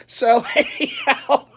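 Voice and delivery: high, slow, laugh-filled words. Real, not acted. Vlogger returning to topic after his joke, laughing. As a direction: push the words out through real laughter.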